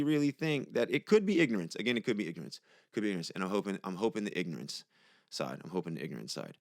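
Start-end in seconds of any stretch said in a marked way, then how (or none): 1.8-6.6 dropping to a soft, low murmur; fading quiet. Real, not acted. Hoping aloud about online commenters, sinking into a low murmur. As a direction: trail off softer and lower each time. Repeats hoping it's ignorance.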